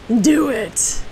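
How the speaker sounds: drawn-out villainous urging; lightly put-on Sith lord voice; Darth Sidious. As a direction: tempt them like an evil master. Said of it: "All right, Darth Sidious".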